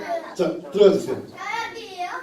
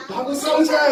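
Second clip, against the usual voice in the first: quicker higher mock challenge; giant's challenge; 7 semitones higher than usual. Shown as a demonstration. Teacher repeats the challenge line for the child, quicker mock shout. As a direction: toss the challenge out again. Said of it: "하고 소리를 질러봐".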